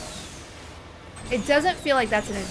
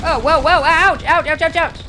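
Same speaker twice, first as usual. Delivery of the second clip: startled yelps; 5 semitones higher, 10 dB louder than usual. Real, not acted.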